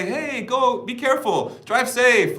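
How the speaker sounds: high, sing-song warning; chirpy mock send-off; mimicking a caring parent. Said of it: "Like, we say that".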